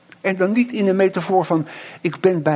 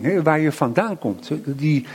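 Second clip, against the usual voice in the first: usual speaking voice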